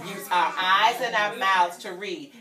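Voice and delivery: normal talking voice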